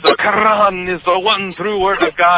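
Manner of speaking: high, emphatic imitation of Egyptian accent; Hussein. Cabbie preaching to his passenger, imitated accent. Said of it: "I guess I'm still doing Hussein".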